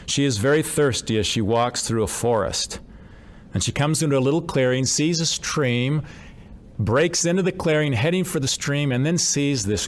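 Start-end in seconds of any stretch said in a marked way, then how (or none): none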